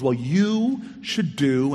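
drawn-out, leaning-in secret tone; string him along, one slow word at a time; slow teasing tease. Retold or imitated. Neighbour leaning in to tease the frustrated mayor, slow teasing.

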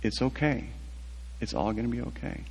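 soft, gently retold comfort; softly reassure, as in a retelling; gentle reassurance. Officer to a man lying in the dirt, gently reassuring. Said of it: "very gently kind of rolls me over".

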